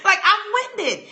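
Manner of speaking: laughing through the words; real laugh while admitting it. Real, not acted. Telling the audience about being winded, laughing.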